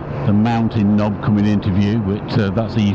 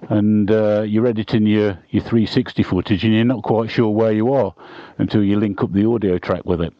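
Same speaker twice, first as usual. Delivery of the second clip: normal talking voice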